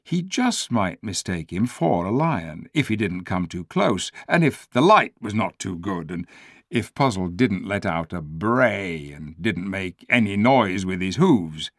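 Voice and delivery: usual speaking voice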